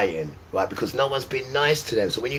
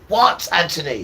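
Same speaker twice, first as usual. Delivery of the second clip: raised, higher mock mum calling back; mum answering; 7 semitones higher, 6 dB louder than usual. Mother answering her son, a hint of a sharp shout. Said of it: "That's how she used to be".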